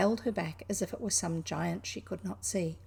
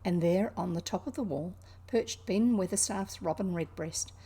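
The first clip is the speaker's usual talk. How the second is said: plain reading voice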